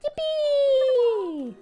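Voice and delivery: high gleeful cheer; excited squeal. Acted. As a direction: squeal with childlike joy. Character cheers.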